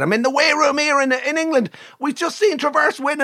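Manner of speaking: high-pitched thrilled jockey, retold; excited phone call. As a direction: imitate a jockey gushing down the phone.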